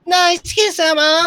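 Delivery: put-on high cutesy anime girl; squeaky-high anime schoolgirl line. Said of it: "some anime girl just comes in, like".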